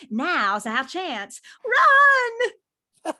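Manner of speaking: rally the crew then yell run; captain urging escape, ending in a shout. Acted.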